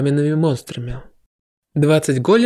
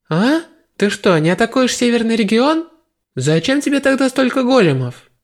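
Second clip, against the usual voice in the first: high puzzled questions; puzzled girl; 7 semitones higher than usual. A narrator's reading. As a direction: ask in bewilderment. Goddess puzzled by hero.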